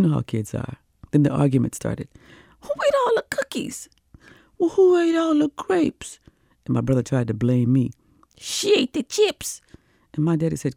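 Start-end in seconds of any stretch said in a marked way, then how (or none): 2.6-6.2 put-on high whispered kid voices bickering; squeaky kids arguing. Siblings in the back seat bickering, high and whispered. 8.4-9.6 put-on high, slow little-boy tattle; tattling kid. Little brother blaming his sister, high and tattling.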